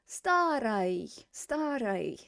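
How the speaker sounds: "старой" slow, stress on "ста", ending reduced to "ruy"; unstressed ой. Shown as a demonstration. Teacher modelling pronunciation, slow word with reduced ending. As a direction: stretch "ста" and let "ой" weaken in "старой". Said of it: "The ой is not stressed, so it's gonna".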